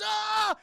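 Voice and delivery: high, loud drawn-out scream; hammed-up falling scream; mock terrified scream. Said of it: "this is terrifying".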